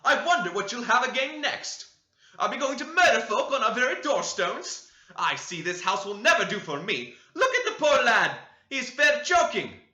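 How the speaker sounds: high, loud, bustling scold; fussing housewife. A narrator's reading. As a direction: fuss and scold all at once. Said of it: "she cried".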